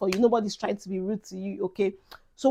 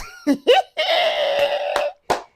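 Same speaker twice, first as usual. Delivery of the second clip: shrieking laugh. Real, not acted. Laughing at friend's Port Harcourt remark.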